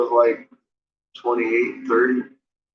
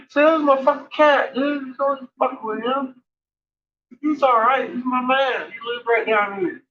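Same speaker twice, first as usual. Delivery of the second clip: lightly voiced homeboy, pitched higher; vouching for a friend; 12 semitones higher than usual. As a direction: vouch for him, high and firm. Friend vouches for him to gang.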